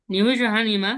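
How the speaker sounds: ordinary delivery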